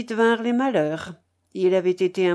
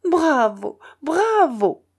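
higher-pitched, delighted bravo, rising on the second; cheering cry; 6 semitones higher, 4 dB louder, slower than usual. A narrator's reading. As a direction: cry out applause to the performer. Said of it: "et cria".

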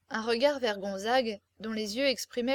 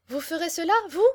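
high, rising incredulous question; astonished delight; amazed exclamation; 6 semitones higher, 6 dB louder than usual. A narrator's reading. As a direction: cry out in disbelief, leaping up on the last word. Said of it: "s'écria-t-elle".

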